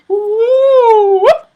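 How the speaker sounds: hammed-up retch noise; mock retching whoop. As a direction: make a drawn-out vomiting whoop. Imitating sick sound.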